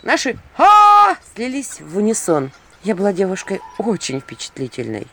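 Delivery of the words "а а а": high loud scream. Acted. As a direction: keep screaming. Screams join in unison.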